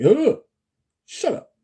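high loud exasperated oh; a sudden fed-up yelp; mock exasperated oh. Mock annoyed reply to wind.

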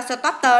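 "stop tờ" with the ED as a separate t plus schwa. Shown as a demonstration. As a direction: say "stop tờ", sounding out the final t as its own beat. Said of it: "là chữ tờ coi".

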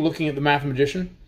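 ordinary delivery, nothing marked

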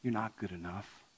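soft, head-shaking Jesus; Jesus gently telling the rich man he's not good enough; gentle rebuke. Acted. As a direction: sadly tell them they fall short. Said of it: "picture Jesus sort of shaking his head going like".

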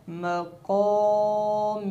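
मक़ामी drawn out; waqf form. Shown as a demonstration. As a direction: recite मक़ामी slowly. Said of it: "ऐसे ही पढ़ी जाएगी".

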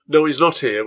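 short, loud brush-off; curt. Acted. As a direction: cut him off flat and fast. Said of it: "was the short answer".

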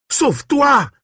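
very high, desperate cry; urgent shout. A narrator's reading. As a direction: shout to make him run. Priest urges boy to flee.

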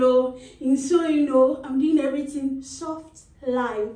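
usual speaking voice

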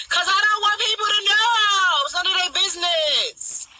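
high, loud, slow protest; nearly shouting it out; exasperated high yell; heated outburst. Real, not acted. Heated rant about privacy.

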